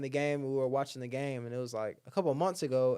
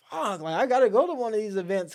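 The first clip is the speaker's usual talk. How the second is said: higher and louder, all fired up; eager excitement; 8 semitones higher, 7 dB louder than usual. Retold or imitated. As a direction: sound itching to go right now. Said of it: "I was like".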